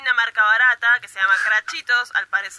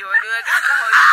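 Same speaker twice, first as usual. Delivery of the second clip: exasperated retort; 3 semitones lower, 4 dB louder, faster than usual. Retold or imitated.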